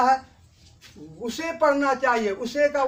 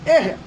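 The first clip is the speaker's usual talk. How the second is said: ए said loud and forceful; ए punched with force; 6 dB louder than usual. Shown as a demonstration. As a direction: give ए a strong push. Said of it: "थोड़ा जोर लगा देना है".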